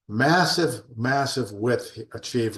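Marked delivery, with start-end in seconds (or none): 0.1-0.8 emphatic exclamation. Real, not acted. Exclaims result of expansion.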